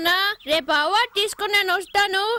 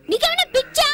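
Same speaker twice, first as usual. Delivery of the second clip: shocked outburst; 11 semitones higher, 4 dB louder than usual. Acted. Daughter stunned by father's demand.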